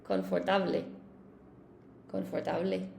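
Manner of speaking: "Confortable" with each Spanish vowel sounded; trying Spanish pronunciation. Shown as a demonstration. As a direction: try "Confortable" as Spanish con-for-TA-ble. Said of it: "how to pronounce it in Spanish".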